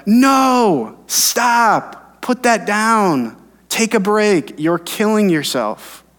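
high, urgent cries, slowing on put that down; urgent pleading call. Retold or imitated. God urging the pastor to stop and rest, high urgent plea. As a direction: call out to stop someone hurting themselves. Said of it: "It sounded more like".

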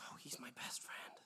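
soft mock whisper; imitating whisper. Imitating the girlfriend whispering about the roommate. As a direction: hush it like a secret. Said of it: "she starts whispering".

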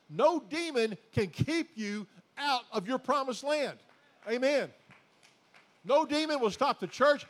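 loud, high preaching declaration; the preacher rallies the church with a fiery build; preaching at full force. Acted. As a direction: thunder it at the congregation.

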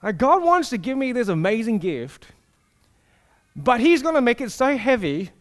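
normal talking voice

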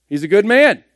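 a crowd member insisting he's good, said loud and high; emphatic voicing of the crowd. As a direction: proclaim the crowd's verdict with punch. Repeating one group's verdict, emphatic.

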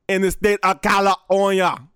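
put-on heavy Austrian accent, Schwarzenegger style; mock Arnold saying "In the state of California"; Schwarzenegger impression. Comic mimics Arnold Schwarzenegger's Austrian accent. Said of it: "his dialect".

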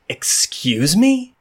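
high, slow, offended Excuse me; indignant excuse me. Acted. Akreya snapping back at Marin, slow and high. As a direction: drag it out high and affronted. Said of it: "she looks at you and she goes".

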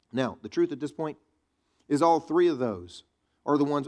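usual speaking voice

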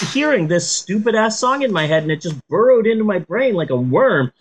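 normal talking voice

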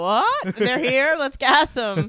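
loud, high-pitched eager imitation; mock excitement. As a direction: pipe up as if suddenly thrilled, hammed-up. Acts out excited dog's reaction.

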